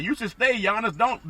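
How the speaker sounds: usual speaking voice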